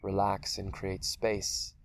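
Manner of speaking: unhurried meditation voice with long pauses; guided meditation. Acted. Teacher leading listeners through a guided meditation, calm and spacious. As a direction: guide them gently through a body scan, leaving space between phrases.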